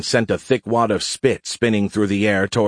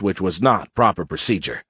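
usual speaking voice

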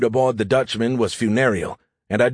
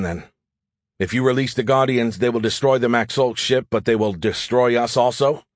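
usual speaking voice

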